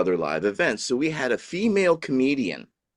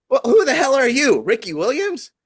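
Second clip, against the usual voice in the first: much higher and louder mock heckle; comedian teasing; 6 dB louder than usual. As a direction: razz the guy in the crowd like a comic. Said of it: "and she goes".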